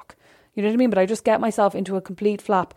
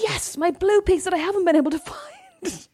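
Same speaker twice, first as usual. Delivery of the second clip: high-pitched, thrilled reenactment; mock-elated outburst, high; retold excitement; 11 semitones higher than usual. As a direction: act out jumping on good news. Retold delight at found puzzle piece.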